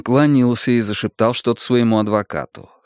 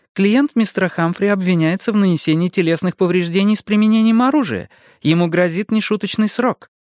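higher, firm woman's voice for the lawyer; female lawyer voice; 9 semitones higher than usual. A narrator's reading. Narrator voices female lawyer Annet.

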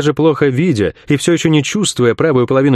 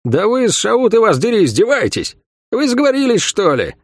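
indignant, high-pitched calling out; exasperated raised voice, much higher; loud call; 10 semitones higher than usual. A narrator's reading. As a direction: call them out with pitch leaping up. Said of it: "Лавиани сказала громко".

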